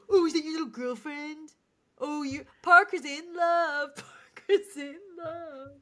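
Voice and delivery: high, loud singsong taunt; mock him like playground bullies; teasing kids. Kids teasing Parker in retold scene.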